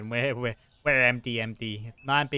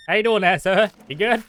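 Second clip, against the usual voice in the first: much higher, much louder cheery greeting; hammed-up shopkeeper greeting; 8 semitones higher, 12 dB louder than usual. Shopkeeper greeting a customer at the till, hammed-up cheery call. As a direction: sing it out like an overeager clerk.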